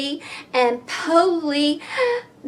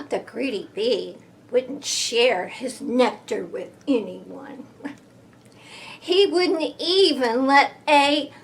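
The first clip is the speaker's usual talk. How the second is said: usual speaking voice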